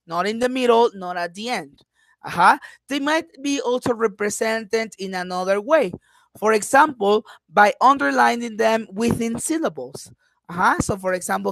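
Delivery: normal talking voice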